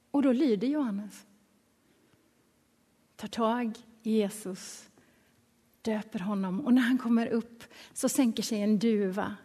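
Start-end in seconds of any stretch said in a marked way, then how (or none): none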